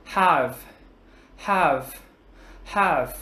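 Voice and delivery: "Have" opening on a puff of air like Vietnamese "hờ"; breathy unvoiced H. Shown as a demonstration. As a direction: say "have" singly, with an unvoiced H and falling tone. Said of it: "giống chữ hờ trong tiếng Việt". (đọc "have" với âm H bật hơi, không rung thanh quản)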